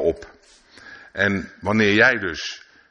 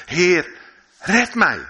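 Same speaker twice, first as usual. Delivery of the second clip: much higher, louder cry for help; desperate cry; 10 semitones higher, 4 dB louder, much slower than usual. Acted. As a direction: call out like a man going under. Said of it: "en schreeuwde". (Petrus roept wanhopig en luid om hulp)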